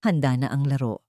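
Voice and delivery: usual speaking voice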